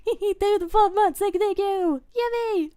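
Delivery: high, loud squeaky thanks; giddy high-pitched gushing; excited squeal. Real, not acted. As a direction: squeal it out with delight. Thanking a subscriber.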